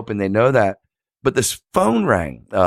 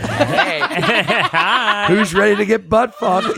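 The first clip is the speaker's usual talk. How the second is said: high, laughing mock come-on; 10 semitones higher than usual. Picture caption voiced as a laughing tease. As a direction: ham up a cheeky invitation.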